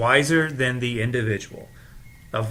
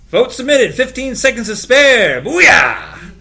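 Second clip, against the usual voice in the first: higher and louder, a triumphant whoop; triumphant shout; 10 semitones higher, 11 dB louder, slower than usual. Acted. Voting at the last second, he shouts in triumph. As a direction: celebrate beating the clock out loud. Vote submitted just in time.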